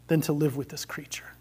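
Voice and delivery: hushed, defeated whisper. Acted. Ghost conceding to the angel in a whisper. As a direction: let the words fall to a whisper.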